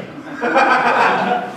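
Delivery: breathy laugh at own joke; light chuckle into the mic. Real, not acted. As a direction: laugh at yourself.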